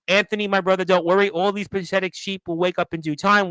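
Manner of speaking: ordinary delivery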